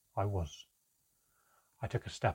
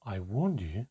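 plain reading voice

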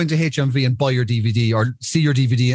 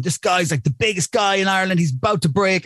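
hyping up; 8 semitones higher than usual. Retold or imitated.

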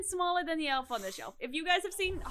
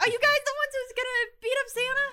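little elf voice; 10 semitones higher, 3 dB louder than usual. Acted.

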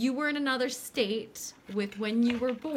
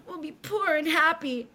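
high, loud fake sob; hammed-up crying, pitched high; mock tearful plea; 7 semitones higher, 6 dB louder than usual. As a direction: whimper it like a lovesick boy. John's tearful reply.

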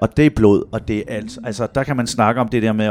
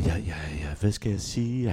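low, very soft, unsure mumble; hesitant young boy; 8 semitones lower, 11 dB quieter than usual. Retold or imitated. Nine-year-old to therapist, hesitant and quiet. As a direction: imitate a boy unsure what to say. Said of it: "Og Valdemar".

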